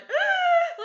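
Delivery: high, very loud delighted shriek; excited squeal. Retold or imitated. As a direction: squeal with sudden joy. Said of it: "Like, I was so excited".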